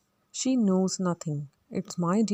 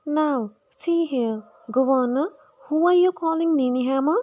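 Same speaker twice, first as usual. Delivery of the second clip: put-on higher, louder Cockney girl's voice; Eliza; 7 semitones higher, 6 dB louder than usual. Eliza protests at Higgins calling her a ninny hammer, high and loud. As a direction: bristle with high-pitched indignation.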